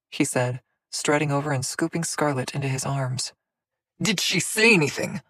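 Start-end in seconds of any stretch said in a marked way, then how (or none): 4.0-5.2 higher tense snarl. A narrator's reading. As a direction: snarl the question. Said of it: "Soren snarled".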